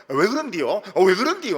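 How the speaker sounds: Jeolla dialect quarrel. Retold or imitated. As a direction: imitate a Gwangju churchgoer arguing loudly. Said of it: "전라도 광주에서 교회 또 싸우는 거 보면".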